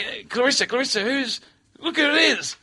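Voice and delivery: high-pitched, eager calling over; put-on high excited voice; imitated excited woman. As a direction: mimic someone spotting a celebrity. Woman excitedly alerting a colleague.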